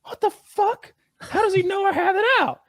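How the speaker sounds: very high, strained imitation of his own shock; mock shocked. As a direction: squeak it out in disbelief. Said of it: "And I'm like".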